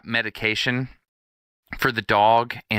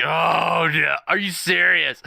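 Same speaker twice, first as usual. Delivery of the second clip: imitated passenger groan; 6 semitones higher, slower than usual. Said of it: "you could hear the groans".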